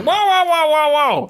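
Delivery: high, loud backing-off whoas; intimidated. Acted. Half-orc backing away from a threatened radish, high and alarmed. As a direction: back off fast, scared. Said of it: "He's like".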